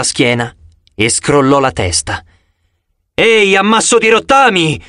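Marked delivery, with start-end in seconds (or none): 3.1-4.8 yelling. A narrator's reading. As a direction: shout up to draw the monster's attention. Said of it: "urlai io".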